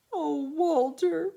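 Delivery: put-on high, slow sad little girl; tearful plea. A narrator's reading. Betty crying in bed to her dog, high and sad. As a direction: sigh it out through tears.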